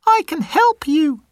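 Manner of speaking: very high, squeaky little mouse; a small mouse taking charge; tiny mouse voice. Acted. Mouse telling the trapped lion to stay still, very high and squeaky.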